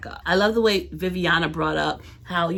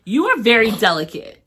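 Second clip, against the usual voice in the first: teasing; 5 semitones higher, 7 dB louder than usual. Real, not acted. Teasing Vinny loudly.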